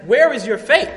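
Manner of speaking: loud, high challenge; emphatic rebuke. Acted. Jesus to his frightened disciples on the boat, loud rebuke. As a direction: fire the question out in reproach.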